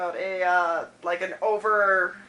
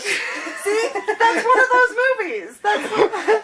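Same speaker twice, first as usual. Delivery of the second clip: laughing; 11 semitones higher, 6 dB louder, much slower than usual. Real, not acted. Laughing at friend's reaction.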